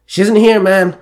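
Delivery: high, loud exasperated report; sighing. A narrator's reading. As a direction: report it with worried frustration. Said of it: "Max sighed".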